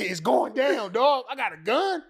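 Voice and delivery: loud, high, pumped up; fired up about the scene; amped-up holler; hyped shout. Retold or imitated. Retold hype at getting a gun.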